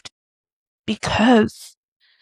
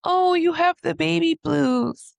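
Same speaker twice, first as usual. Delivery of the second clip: imitated doctor, higher, lightly cooing; a hint of patronising doctor, pitched up; doctor's brush-off; 7 semitones higher than usual. Doctor to crying new mother, lightly imitated high reassurance. Doctor dismissing her with a label.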